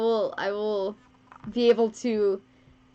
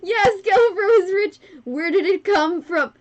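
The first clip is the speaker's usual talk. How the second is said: loud, higher cheering; mock cheer; 6 semitones higher, 6 dB louder than usual. Streamer cheers that donor Skelepro is rich, loud and higher. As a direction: cheer like a game show host.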